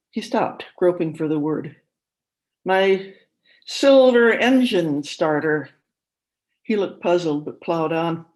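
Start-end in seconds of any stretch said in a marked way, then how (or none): 2.6-5.7 put-on suspicious old father. A narrator's reading. Old father accusing daughter-in-law, loud and slow. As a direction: accuse her slowly, sure she steals from you.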